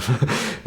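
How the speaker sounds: 높은 웃음 터뜨림; high burst of laughter. Real, not acted. Laughs at own shoe-man joke.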